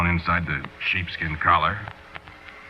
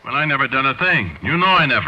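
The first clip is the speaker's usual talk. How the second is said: higher and louder, pleading innocence; protest, scared, voice rising; panicked protest; 8 semitones higher, 3 dB louder than usual. Acted. Accused man protests.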